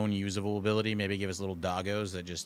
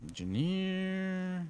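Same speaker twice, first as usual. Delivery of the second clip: drawn-out high singsong; 10 semitones higher, much slower than usual. Real, not acted. Drawn-out game callout.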